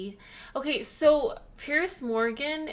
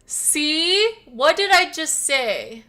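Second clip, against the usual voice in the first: loud, triumphant told-you-so; loud I-told-you-so to her friend; told-you-so outburst; 5 semitones higher, 11 dB louder than usual. Real, not acted. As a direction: crow that you called it, loudly.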